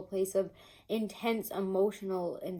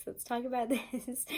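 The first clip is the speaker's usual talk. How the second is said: higher pitch, laughter breaking into the words; holding back laughs; 4 semitones higher than usual. Real, not acted. Reader starting on Gemini's cards, trying to hold back laughs. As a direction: say it while trying not to laugh. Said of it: "trying to hold back some laughs".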